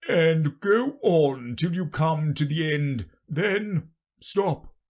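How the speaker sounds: weighty king giving instructions; grave king. Acted. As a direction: draw out each step pompously. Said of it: "the king said gravely".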